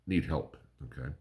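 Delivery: ordinary talk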